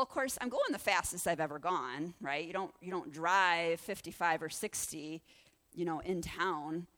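ordinary talk, nothing marked

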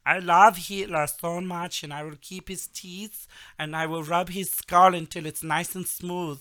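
high, loud mock Russian cosmonaut voice; Russian accent. Podcast host imitating a Russian cosmonaut, in a hammed-up Russian accent. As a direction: ham up a bad Russian accent. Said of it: "I can't do Russian accent".

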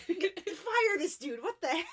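high, drawn-out indignant complaint; mock outrage. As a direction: play an appalled grandma demanding action. Grandma outraged at the screaming visitor.

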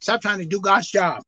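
ordinary talk, nothing marked

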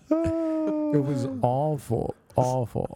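stretched high and slow, disgusted; drawn-out disgust. Real, not acted. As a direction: wail it out like it's unbearable. Disgusted over the fart story.

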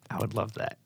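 joking whisper back. Pretend. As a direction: answer in a playful hush.

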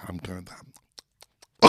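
slow, unvoiced whisper. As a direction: whisper it slowly, building suspense. Whispered bit of the story.

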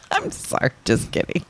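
unvoiced, laughing breathlessly; whispered laughing apology. Real, not acted. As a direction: whisper it while cracking up.

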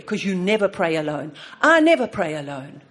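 normal talking voice

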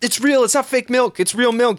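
normal talking voice

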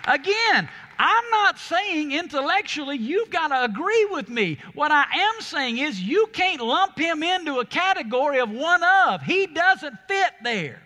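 loud, high, driving sermon delivery; fiery pulpit preaching to the congregation; full-force preaching. Acted. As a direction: preach it with everything, building to a roar.

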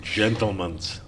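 hint of a Russian accent; a soft Russian accent. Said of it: "we're gonna read someone in a Russian accent".

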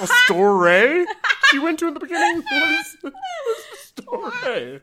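high squealing laughter through the words; laughing hysterically. Real, not acted. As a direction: shriek the question while cracking up. Cracking up at the story.

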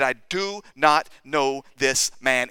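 "do not know this man" slow, word by word; measured, deliberate pledge with each word set apart; earnest assurance. Retold or imitated. Peter assures the accusers, slow earnest denial. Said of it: "he was telling these people, "I, I assure you".

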